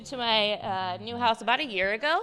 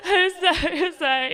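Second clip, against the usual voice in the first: high, louder wailing apologies; mock sobbing; 9 semitones higher, 6 dB louder than usual. Daughter apologizing to mom, mock sobbing wail. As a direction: blubber it like a crying kid. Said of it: "she's sobbing".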